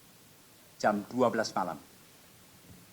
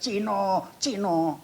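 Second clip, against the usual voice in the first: high, drawn-out crowd cry; retold mob yell; 8 semitones higher, much slower than usual. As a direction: imitate villagers yelling from afar. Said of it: "Mereka yelling, teriak-teriak".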